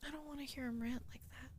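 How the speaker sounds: soft whispered aside. Real, not acted. Player whispering an aside about the narrator's rant. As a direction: whisper so he won't hear.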